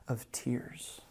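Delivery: whispered end of the quote. Acted. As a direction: trail off into a whisper. Quote ends on tears.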